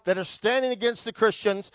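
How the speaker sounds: normal talking voice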